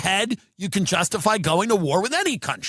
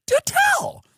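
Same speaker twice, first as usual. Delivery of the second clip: mock astonished squeal. Comic to a friend, high mock astonishment. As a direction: squeak it in fake amazement. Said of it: "I go".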